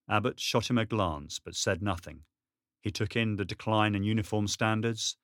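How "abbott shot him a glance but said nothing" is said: plain reading voice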